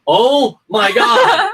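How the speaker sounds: high, loud, slow, laughing oh my God; exasperated outburst. Retold or imitated. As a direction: stretch it out in laughing despair. Wife bursts in, fed up.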